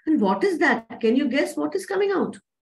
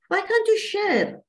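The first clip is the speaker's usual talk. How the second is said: put-on high pleading girl; 9 semitones higher than usual.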